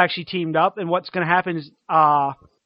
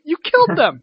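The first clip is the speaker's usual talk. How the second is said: shocked shout; 10 semitones higher, 5 dB louder than usual. Retold or imitated.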